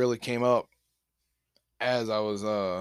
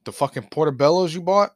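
retold accusation; 5 semitones higher, 7 dB louder than usual. Retold confrontation, accusing friend.

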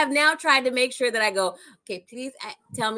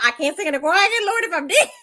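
laughing quote; 7 semitones higher, 4 dB louder than usual. Retold or imitated. Mocking the prayer while laughing.

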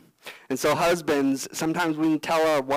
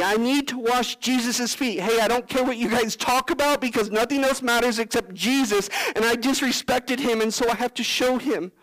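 higher, hurried, keen apology; eager, urgent apology; 7 semitones higher than usual. Pretend. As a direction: rush to make amends. Continues Simon's eager apology.

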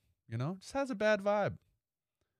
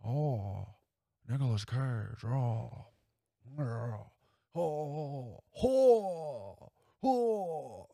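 a light, rising Nicolas Cage warm-up, oh after oh; loosening up into Nicolas Cage mode; Nicolas Cage impression; much slower than usual. Podcaster warming up his Cage voice, rising impression. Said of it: "I need to get into Nick Cage mode".

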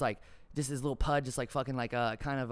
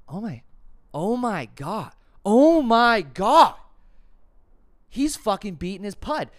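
louder and higher, stretched out; shocked outburst; 7 semitones higher, 12 dB louder, slower than usual. Retold or imitated. Engineer realising the footage, building to a slow shocked shout.

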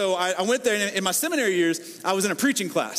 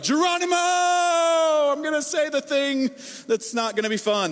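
Geronimo leap; 7 semitones higher than usual. Pretend. Bracing before a hard talk, high excited cry. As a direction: throw yourself off the cliff with it.

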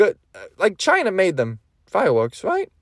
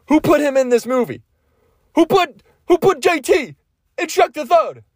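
high, hammed-up indignant yelling; exasperated, pitched-way-up comic rant; mock outrage; 11 semitones higher, 3 dB louder than usual. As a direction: demand answers in comic disbelief. Acted outrage over casting.

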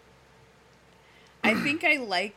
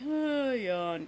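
mock boredom; 6 semitones higher than usual. Voices boredom at weak jokes.

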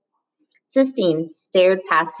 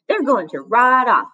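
higher and louder jeering yell; 6 semitones higher, 4 dB louder than usual. Acted. Bully yelling at Rob from the bus, jeering yell. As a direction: hurl it at him mockingly. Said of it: "Norton yelled".

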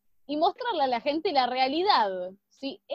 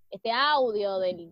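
ordinary delivery

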